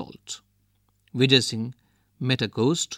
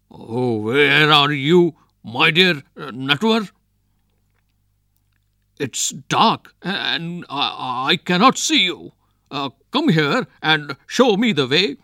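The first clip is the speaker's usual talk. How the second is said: calling out bravely; 3 semitones higher, 7 dB louder than usual. A narrator's reading. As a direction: put on a brave front and shout into the night. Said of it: "Trying to sound brave, he called back".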